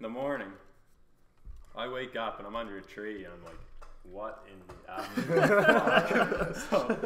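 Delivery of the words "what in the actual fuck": slow, drawn-out, higher and louder, laughing through it; waking hungover and bewildered, a slow laughing what-the-hell; stunned disbelief. Retold or imitated. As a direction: stretch it out in baffled shock, half-laughing. Waking up under a tree.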